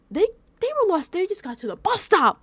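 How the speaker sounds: high, loud exclamation of disbelief; exasperated. Real, not acted. Telling viewers how lost they were, exasperated.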